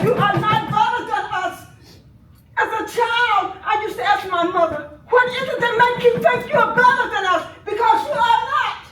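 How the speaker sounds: loud, high, furious outcry; angry shout. Real, not acted. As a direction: shout it at them in grief and rage. Grieving aunt denouncing police killing.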